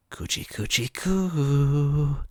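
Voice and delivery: a hint of creepy cooing; mock creepy coo. Henchman cooing before the tickling, slow. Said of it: "he whispered in my ear".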